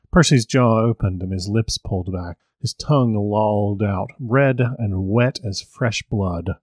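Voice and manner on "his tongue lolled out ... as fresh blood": usual speaking voice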